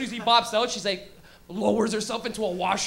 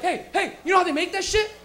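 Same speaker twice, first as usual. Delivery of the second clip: loud, higher, pushy explaining; eager know-it-all; 6 semitones higher, 4 dB louder than usual. Retold or imitated. Stoner to a stranger, loud and eager know-it-all. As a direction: grab them and lecture them excitedly.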